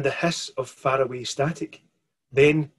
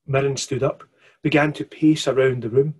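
ordinary delivery

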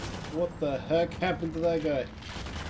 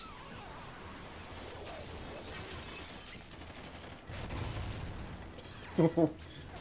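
long breathy laugh; 9 dB louder than usual. Real, not acted. Player laughs at game.

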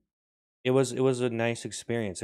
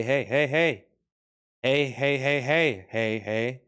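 dolphin-like calls; 3 semitones higher, 5 dB louder, slower than usual. Acted. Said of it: "You can say".

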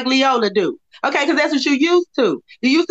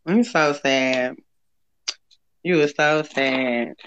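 mock sad; 9 semitones lower, 4 dB quieter, much slower than usual. Mocking his sadness.

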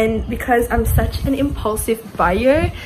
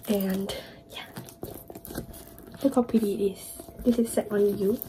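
whisper; 9 dB quieter than usual. Real, not acted. Hushed admiring of new books.

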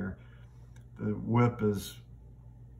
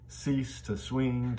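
slow, lilting stress on cease and swing; iambic rhythm; 2 semitones higher, 3 dB quieter, much slower than usual. Shown as a demonstration. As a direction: swing it gently, da-DUM da-DUM. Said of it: "iambic pentameter".

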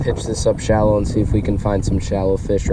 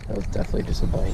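whispering; 2 semitones lower, 7 dB quieter, faster than usual. Real, not acted. Quiet reaction to a bite.

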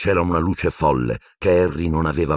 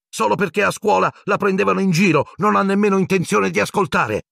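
high, outraged protest; shouted accusation. Acted. Pupil accusing his teacher, high angry shouting. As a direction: keep the pitch up, indignant. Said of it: "gridò Harry".

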